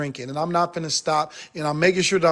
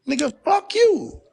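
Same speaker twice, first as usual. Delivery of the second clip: pitched high and slow, spitting it out; high angry retort; 9 semitones higher, slower than usual. Acted. Fed-up man cursing out people he tried to help, high and slow. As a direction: throw it back hard and slow.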